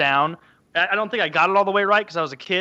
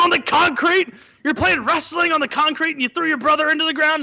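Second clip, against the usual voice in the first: incredulous parent; 11 semitones higher than usual. Retold or imitated. Parent scolding wrestling on concrete.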